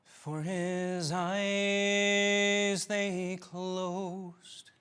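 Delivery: slow, hushed dramatic poem reading; solemn recitation. Acted. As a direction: recite each line slowly, like a eulogy.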